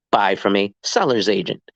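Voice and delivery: usual speaking voice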